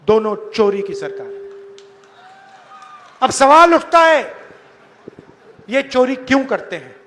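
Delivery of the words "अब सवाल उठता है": ordinary talk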